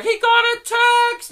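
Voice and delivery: high-pitched imitated scream; mock screaming. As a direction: scream it like an overexcited reality contestant. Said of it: "and everyone's like".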